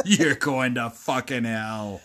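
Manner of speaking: slow, hammed-up evil voice; drawn-out villain gloat with a cackle. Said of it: "that was my best, like, evil voice".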